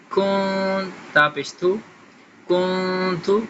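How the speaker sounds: کن تو with the نون hidden in a nasal sound; ikhfa recitation. Shown as a demonstration. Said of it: "یہاں بھی اخفا". (کن تو میں اخفا، ناک کی آواز)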